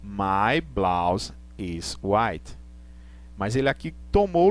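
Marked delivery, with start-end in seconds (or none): 0.0-2.6 slow, lower, stressing my; slow model sentence. Shown as a demonstration. Teacher contrasting the adjective for students, slow. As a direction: lay it out slowly, leaning on my. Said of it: "eu tenho que dizer".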